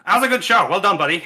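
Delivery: good-natured champion voice; the champ, praising. Acted. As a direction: clap the loser on the back with your voice. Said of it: "looks like".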